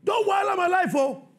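high, loud sermon delivery; preaching at full force. Acted. Preacher stirring the church about Jesus' command, shouting. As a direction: hammer the point to the congregation.

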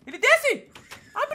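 high, loud alarmed yell; panicked shout. Real, not acted. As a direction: yell a desperate warning. Alien drops down, needs door opened. (berro de alarme)

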